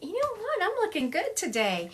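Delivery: high-pitched pleased self-compliment; pleased self-talk. Acted. Someone passing the mirror on the way out, pleased self-talk. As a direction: lift it up high, admiring yourself.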